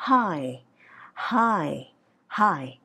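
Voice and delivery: each "hi" long, sliding gently down; English up-down glide. Shown as a demonstration. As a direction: let "hi" glide up, then fall. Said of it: "Mà rõ ràng là hi". (đọc "hi" lên rồi xuống, kéo dài)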